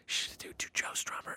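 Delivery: urgent-sounding shushed whisper; hushed whisper. Retold or imitated. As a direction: whisper it like a secret passed along. Overheard background talk retold.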